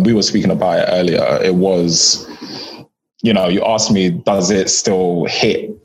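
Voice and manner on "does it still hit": ordinary talk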